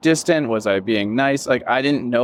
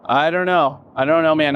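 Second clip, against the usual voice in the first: a laugh breaks into a higher, wry I don't know; 5 semitones higher than usual. Real, not acted. Telling his host about pushing himself, laughing it off. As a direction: chuckle through it, shaking your head at yourself.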